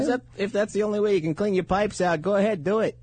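a hint of a Brooklyn wife giving permission; Brooklyn housewife. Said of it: "my wife talks like a, a Brooklyn housewife".

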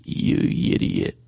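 very low, voiceless mumble; sister's code voice. Acted. Talking to his sister in their secret code, low grunt. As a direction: grunt it barely moving the jaw. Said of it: "We talk like this".

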